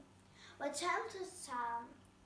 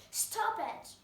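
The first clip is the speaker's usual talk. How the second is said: higher, loud command; cried out; 6 semitones higher, 3 dB louder than usual. A narrator's reading. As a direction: cut them off sharply. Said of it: "cried the queen".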